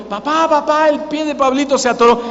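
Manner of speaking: high-pitched imitation of a child calling dad; alarmed child. Son tells father about brother's stuck foot, imitated child's alarm. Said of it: "uno de mis hijos me dijo".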